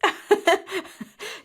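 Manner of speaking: high bursting laugh at the question; real amused laugh; bright laugh. Real, not acted.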